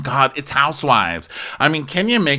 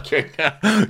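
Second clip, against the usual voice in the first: normal talking voice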